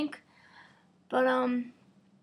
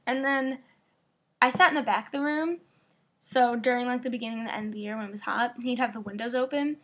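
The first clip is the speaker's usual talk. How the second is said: normal talking voice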